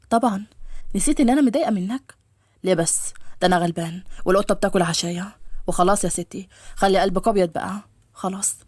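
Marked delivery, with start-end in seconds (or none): 2.6-8.6 low male voice playing the poor innocent; pitched down, pleading lightly for forgiveness; coaxing young man. (صوت شاب منخفض يستعطف)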